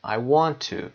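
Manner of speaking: "to" said fully as too; stressed "to". Shown as a demonstration. Teacher repeating the strong form, stressed "to". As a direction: draw out the oo in final "to". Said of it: "به این شکل".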